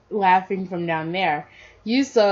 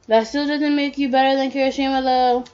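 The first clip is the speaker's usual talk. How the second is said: high, lightly raised yell; yelled taunt; 6 semitones higher than usual. A narrator's reading. Parent taunting Bakugo from a distance, mild yell. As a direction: call it out on a high, sustained pitch. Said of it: "you yelled".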